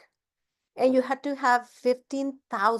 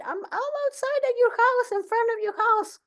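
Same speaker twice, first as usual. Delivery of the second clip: much higher, eager asking; high eager leader; 4 semitones higher than usual. Retold or imitated. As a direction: sing it up as a keen recruit. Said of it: "she just said".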